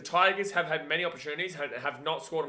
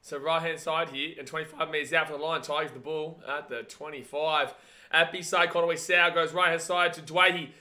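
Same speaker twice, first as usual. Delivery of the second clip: live play-by-play; 2 semitones higher, 8 dB louder, slower than usual. Real, not acted. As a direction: build the call into a long rising cry on the last word. Live play-by-play over the match.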